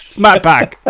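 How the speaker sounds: high, loud Boston accent imitating a commercial; a loud mock Boston ad jingle line. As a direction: shout it like the Boston car ad. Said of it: "that stupid Smart Park commercial".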